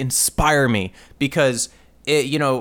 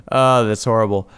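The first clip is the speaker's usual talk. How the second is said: ordinary talk, nothing marked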